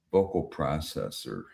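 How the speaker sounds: ordinary talk, nothing marked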